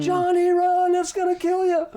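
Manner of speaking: very high, loud panicked shriek; scream. Retold or imitated. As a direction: shriek a frantic warning to a child. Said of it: "she screams".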